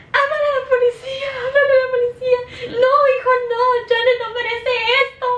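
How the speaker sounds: high, fast, frantic grandma's cry; panicked grandma. Retold or imitated. Grandma begging someone to call the police, high and frantic. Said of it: "my grandma's like".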